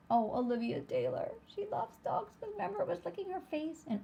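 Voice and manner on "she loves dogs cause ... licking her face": higher, fast, delighted; excited high aside. Real, not acted. Teacher excitedly recalling the dog licking her face. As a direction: gush about it.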